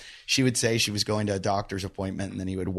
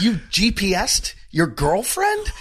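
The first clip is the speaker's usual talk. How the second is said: incredulous; 9 semitones higher, 3 dB louder, slower than usual. Retold or imitated. Retold disbelief at friend.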